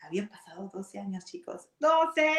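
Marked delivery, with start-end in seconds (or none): 1.8-2.3 emphatic twelve. Real, not acted. Telling audience the years gone, loud ¡Doce!. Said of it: "stresses twelve years".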